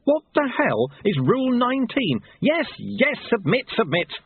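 high, frantic outcry; desperate yelping submission; pained surrender. Acted. Pinned pupil yelps and gives in.

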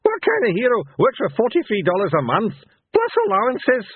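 high-pitched, cackling mockery from an old madman; Mad Hamish. A narrator's reading. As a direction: crow it scornfully, very high. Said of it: "cackled Mad Hamish".

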